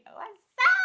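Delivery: high, loud squeal of hello with a laugh; excited greeting. Real, not acted. Vlogger greeting her returning viewers, squealing hello with a laugh.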